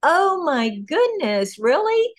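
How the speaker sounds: slow "oh my goodness" climbing into a very high squeaky "really?"; retelling finding out her favourite author coaches writers, amazed; amazed disbelief. Retold or imitated. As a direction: replay the moment of stunned delight, pitch shooting up. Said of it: "And I'm like".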